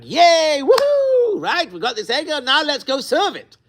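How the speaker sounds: high, giddy cheer of the crowd, pitch way up; hammed-up whoops of joy; mock excited cheer. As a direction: cheer like a crowd thrilled with its new idol. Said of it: "It was like".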